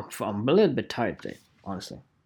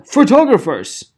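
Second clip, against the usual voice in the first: loud, higher all-caps shout; exclaimed ad; 8 semitones higher, 10 dB louder than usual. Retold or imitated. Reading a shouty photographer ad aloud, mock loud call. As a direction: yell it like three exclamation points. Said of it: "Exclamation point".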